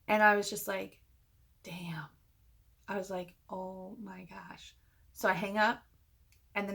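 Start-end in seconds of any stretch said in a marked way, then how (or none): 1.6-2.1 hushed, stunned reaction. Retold or imitated. Narrator reacting to the voicemail, stunned and quiet. 3.5-4.7 slow, hushed disbelief; stunned. Retold or imitated. Narrator realizing who left the voicemail, stunned and quiet.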